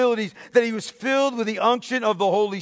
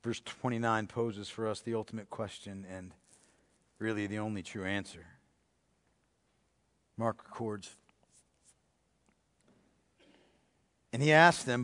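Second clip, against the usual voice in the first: much softer, lower, intimate preaching; hushed sermon reading; 12 semitones lower, 13 dB quieter than usual. Acted. Preacher drawing his congregation into the scene, hushed. Hushed retelling of Mark's account.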